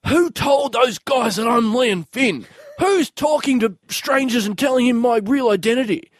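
hammed-up outrage at being found out; mock outraged demand. Retold outraged outburst to friends.

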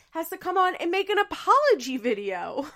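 high, loud incredulity; indignant disbelief. Real, not acted. Defending the nurse forced to apologize, indignant disbelief.

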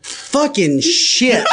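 high, drawn-out disbelieving curse; mock exasperation. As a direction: drag out the swearing in mock dismay. Acted reaction of an alarmed driver.

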